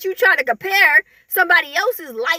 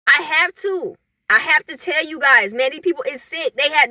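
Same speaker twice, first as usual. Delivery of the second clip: ordinary delivery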